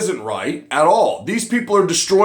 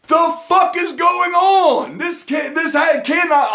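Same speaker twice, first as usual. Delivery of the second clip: outraged shout; 9 semitones higher than usual. Real, not acted. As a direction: shout it in disbelief. Outraged disbelief at news.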